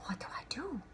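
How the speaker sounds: whispered, breathless and nervous; anxious whisper. Acted. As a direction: ask it in a small whisper. Nervous question to grandmother.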